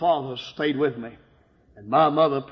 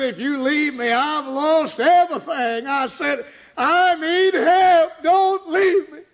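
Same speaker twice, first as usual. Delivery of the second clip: high, louder, breaking plea; desperate pleading; 12 semitones higher, 5 dB louder, slower than usual. Retold or imitated. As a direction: beg her not to leave, voice cracking. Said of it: "I fell on my knees and I begged her".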